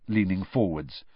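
ordinary delivery

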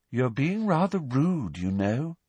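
put-on slow, dreamy, detached girl's voice; serenely. A narrator's reading. Luna gently telling Harry he is rude, slow and dreamy. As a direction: float it out, unbothered. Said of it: "said Luna serenely".

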